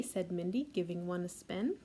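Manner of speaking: usual speaking voice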